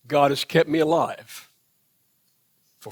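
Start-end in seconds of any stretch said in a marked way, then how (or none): none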